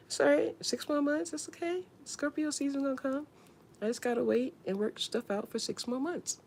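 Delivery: tiny, high, soft voice; mock self-consoling. Scorpio told to wait her turn, mock self-consoling. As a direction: console yourself in a small high voice.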